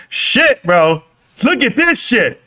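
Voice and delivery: ordinary delivery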